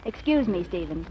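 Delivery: ordinary delivery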